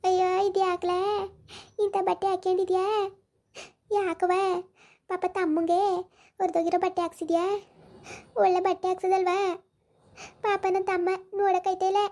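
a mild hint of the fussing elder sister; daughter. Daughter scolds mother about brother. (ಸ್ವಲ್ಪ ಎತ್ತರದ ಧ್ವನಿಯ ಅಕ್ಕ)

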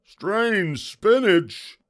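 slow, loud pirate disbelief, pitched high; Braidbeard. A narrator's reading. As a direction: echo it as an appalled pirate. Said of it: "Braidbeard said".

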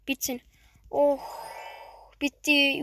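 usual speaking voice

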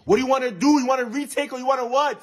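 shouting it across the class, high and loud; loud teacher taunt. Acted. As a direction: announce the failure to everyone. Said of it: "Why are you talking so loud?".

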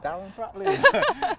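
high, slow Welsh steward voice; Welsh accent. Retold or imitated. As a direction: stretch the name out in a Welsh accent. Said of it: "in a Welsh accent".

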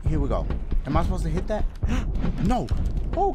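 usual speaking voice

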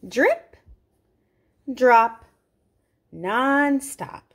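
bouncy sing-song story voice, each word punched; sing-song reading to children; rhyming read-aloud. Acted. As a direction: read it like a picture book chant to kids. Reading rhyming picture book aloud.

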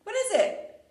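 high, loud, teasing question; playful question. Acted. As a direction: tease the kids with a big curious ask.

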